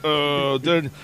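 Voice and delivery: low, rambling football commentator; John Madden. Acted. Football commentator ordering English food, low bumbling impression. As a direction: stumble through it like play analysis, pitched low. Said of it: "him ordering English food".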